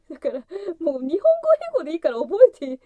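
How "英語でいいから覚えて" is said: louder and faster, stress on "英語"; emphatic. Real, not acted. Vowing to learn at least Japanese-English, loud and quick. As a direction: push it out with fast resolve. Emphatic first take of resolve.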